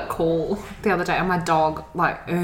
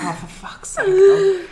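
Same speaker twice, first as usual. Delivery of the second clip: exasperated, rising to a much higher, louder wail on the last word; fed-up owner groaning at her dog; mock exasperation; 5 dB louder, slower than usual. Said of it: "I'm like".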